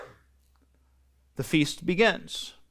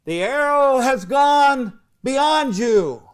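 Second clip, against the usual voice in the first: higher, slow, drawn-out call to someone far off; 9 semitones higher, 4 dB louder, much slower than usual. Retold or imitated. Jonathan calls the signal to his armor bearer, slow long call. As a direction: stretch the call across the field. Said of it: "calls out to his armor bearer".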